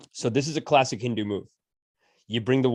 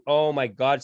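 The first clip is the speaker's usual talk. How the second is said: ordinary talk, nothing marked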